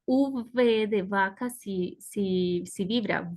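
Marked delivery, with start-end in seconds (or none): none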